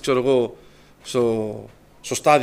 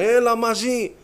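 higher, louder call across the schoolyard; 8 semitones higher, 4 dB louder, much slower than usual. Retold or imitated. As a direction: shout the invite to a friend. Gang calling him after school.

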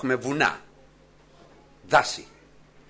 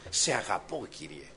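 tender whispered prayer; 6 semitones lower, 7 dB quieter than usual. Shown as a demonstration.

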